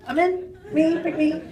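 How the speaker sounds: high, loud, eager outburst; eager volunteering. Retold or imitated. Stunt woman jumping at a free night out, eager. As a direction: jump at it like a kid begging to be picked.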